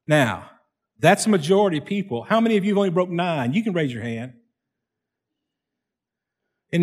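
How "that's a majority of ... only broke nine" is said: ordinary talk, nothing marked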